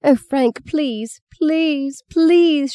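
high-pitched frantic begging; shrill pleading. A narrator's reading. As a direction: beg desperately in a high child's voice. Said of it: "she shrilled".

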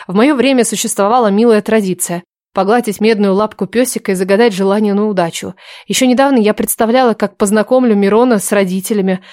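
plain reading voice

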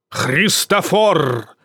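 slow booming echoing call; гулкий голос. Acted. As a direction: call the name out slowly, resounding. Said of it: "вскричал он вдруг гулким голосом". (медленный гулкий зов, как эхо)

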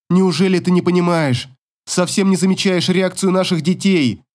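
rising, high-pitched appeal; urgent reproach. A narrator's reading. Mother implores ex to notice children, high urgent reproach. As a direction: press him with mounting indignation.

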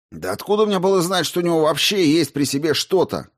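high, heated defence; snapping back. A narrator's reading. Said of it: "огрызнулся Соловей".

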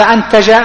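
أنتج with an opening "a", as antaja; misread vowels. Shown as a demonstration. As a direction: say أنتج with fatha on the alif and ta. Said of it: "في العربية تقرأ بالضم".

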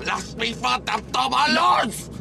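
slow, high angry yell; enraged demand at full voice; furious shout. Acted. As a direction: shout it out, stretching the words. Demands to be let go.

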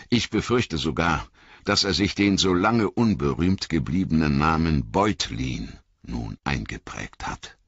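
plain reading voice

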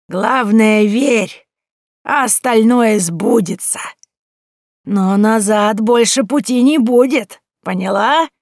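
put-on high, slow, loud sage-like old woman; knowing old woman. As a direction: hand down a prophecy, slow and emphatic. Old woman's parting wisdom.

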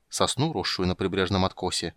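plain reading voice

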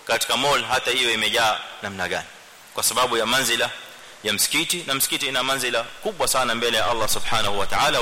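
ordinary talk

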